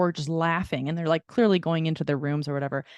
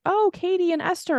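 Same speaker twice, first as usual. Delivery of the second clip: sweet bridesmaid greeting; 11 semitones higher than usual. Retold or imitated. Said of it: "she's like".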